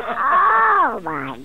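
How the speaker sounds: hammed-up moan. Mock moan skit.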